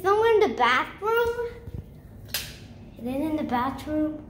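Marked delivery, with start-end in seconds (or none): 3.0-4.2 soft, low musing; subdued question. Real, not acted. Child searching for the balloon pipe, subdued. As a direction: ask it quietly, half to yourself.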